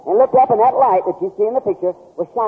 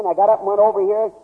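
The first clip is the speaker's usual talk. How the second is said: ordinary talk, nothing marked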